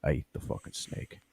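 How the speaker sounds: low, hushed storyteller rasp; Joey Diaz. Pretend. [name] as Joey Diaz recounting eating the snake, low and hushed. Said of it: "That's not a bad D S".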